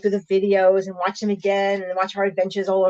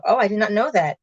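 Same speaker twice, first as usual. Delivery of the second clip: ordinary talk, nothing marked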